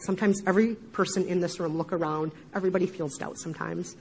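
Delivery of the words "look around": ordinary talk, nothing marked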